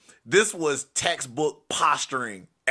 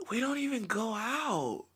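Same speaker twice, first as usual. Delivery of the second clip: put-on high, soft, slow drunken whine; messed-up woman; 10 semitones higher, 6 dB quieter than usual. Smashed woman answering her would-be rescuer, high slurry protest. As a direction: imitate her, slow and pitched way up. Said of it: "her being messed up".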